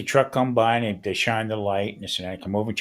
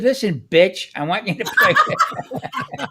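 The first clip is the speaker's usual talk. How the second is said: high, loud comic snap breaking into laughter; mock irate command; 8 semitones higher, 3 dB louder, slower than usual. [name] arguing with Alexa over music, mock irate command. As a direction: boss it about, laughing by the end.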